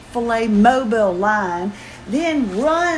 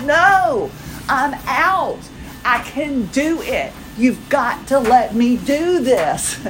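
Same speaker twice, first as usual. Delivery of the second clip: high, drawn-out mock-defiant "no", then insisting; retold stubborn protest; 4 semitones higher, slower than usual. Wife refusing husband's offer to take over, mock-stubborn. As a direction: dig your heels in, playfully.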